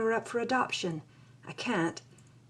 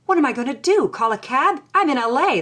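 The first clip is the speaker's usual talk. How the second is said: rising, louder panicked questions; frantic at the water breaking; racing thoughts in a sudden panic; 5 semitones higher, 7 dB louder, faster than usual. A narrator's reading. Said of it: "I panic".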